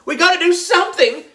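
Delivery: high, loud frightened cry; panicked shout. A narrator's reading. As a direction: panic as if the danger is real. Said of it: "Emily shouted".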